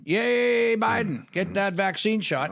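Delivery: a mock fan's cheer for a vaccine shot. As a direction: mimic a crowd cheering him on. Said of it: "and all of people are cheering".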